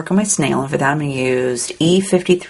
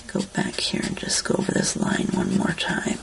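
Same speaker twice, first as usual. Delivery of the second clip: whisper; 7 dB quieter than usual. Real, not acted. Absorbed in colouring.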